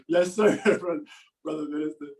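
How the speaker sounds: mock-formal laughing reply; laughing yes sir. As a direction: grin and laugh the words out. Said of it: "we're all like".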